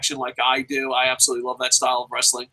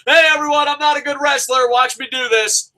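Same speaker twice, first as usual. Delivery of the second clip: mock Batista; 11 semitones higher, 4 dB louder than usual. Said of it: "like Dave Batista".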